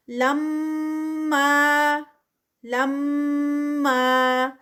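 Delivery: لما with ghunna on the doubled میم and a two-count ما; tajweed recitation. Shown as a demonstration. Teacher models لما for students. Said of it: "یہاں پر بھی غنہ".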